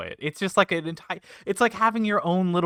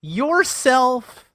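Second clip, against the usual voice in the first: slow, pitchy salesman lines climbing higher; infomercial pitch; 4 semitones higher than usual. Acted. [name] keeps the infomercial sell going. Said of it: "Is this an infomercial?".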